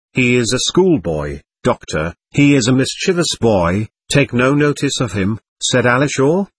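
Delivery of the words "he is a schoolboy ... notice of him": plain reading voice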